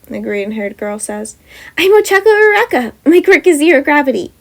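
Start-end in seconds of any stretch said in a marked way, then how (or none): 1.8-4.3 high, loud and cheerful; bubbly. Acted. Bubbly girl introducing her quirk to the teacher, high and cheerful. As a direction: bounce through it. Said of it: "a bubbly short-haired brunette says".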